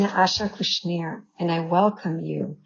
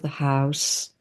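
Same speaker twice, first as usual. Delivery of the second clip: guided meditation. Real, not acted. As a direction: ease them into stillness, phrase by phrase.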